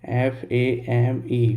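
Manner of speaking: letters spelled one by one, very slowly; spelling the word for students. Shown as a demonstration. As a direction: dictate each letter slowly. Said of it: "मैंने बोला फेम".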